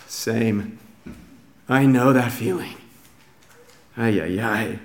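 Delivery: low, soft, world-weary commiseration; acted tired fellow-feeling, under the breath; weary sympathy. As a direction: quietly groan along in sympathy. Voicing Jesus sharing the frustration.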